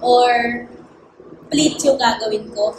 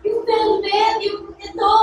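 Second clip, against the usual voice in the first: excited; 10 semitones higher than usual. Retold or imitated. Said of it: "So sabi ko".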